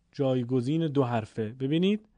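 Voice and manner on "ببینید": usual speaking voice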